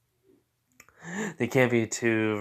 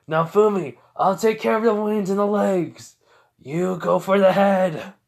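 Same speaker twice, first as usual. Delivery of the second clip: battle commands; 7 semitones higher, 4 dB louder than usual. Acted. Calling plan to ally in battle.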